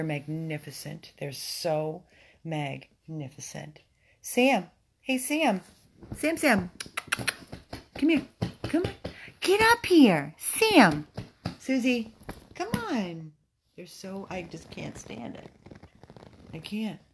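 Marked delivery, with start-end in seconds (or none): 4.2-13.3 loud high call across the room; bright shouted summons; calling the dogs. Real, not acted. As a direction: call the dog to come up.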